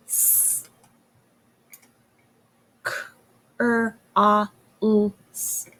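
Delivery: each sound apart; slowly sounding out. Shown as a demonstration. Teacher slowly sounds out crawls for the class. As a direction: break crawls into separate sounds. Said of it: "Let's slowly sound out the next word".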